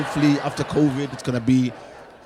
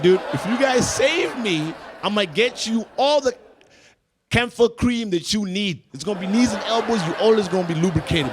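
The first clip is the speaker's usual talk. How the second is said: high-pitched, urgent plea; urgent bargaining; 5 semitones higher, 3 dB louder than usual. Acted. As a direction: bargain desperately with your own body, voice pitched up. Pleading with his elbows to save him.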